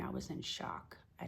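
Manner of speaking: numb, barely voiced; stunned hush. Real, not acted. Telling of the baby's death, hushed and numb. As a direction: voice drops to a near whisper in shock.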